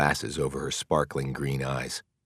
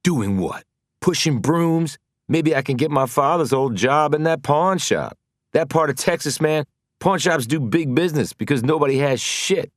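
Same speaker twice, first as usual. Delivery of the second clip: higher, louder scorn; mock his own future angrily; bitter loud sarcasm; 11 semitones higher, 6 dB louder than usual. Mars bitter outburst.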